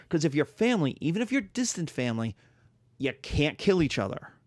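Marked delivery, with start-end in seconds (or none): none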